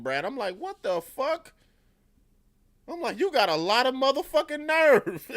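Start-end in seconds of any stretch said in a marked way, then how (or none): 0.6-1.5 very high-pitched disbelief; hammed-up incredulous outburst; incredulous outrage. Viewer reacting to Ghost's insult, incredulous outrage. 3.2-5.3 loud, very high hammed-up indignation; mock-outraged yell tipping into laughter; outraged reaction. Viewer yelling at Ghost for calling her selfish, outraged.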